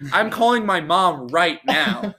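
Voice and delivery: high, slow whiny complaint; hammed-up pleading whine about the pain; mock whine. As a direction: whine it like a hurt kid. Whining about sting pain.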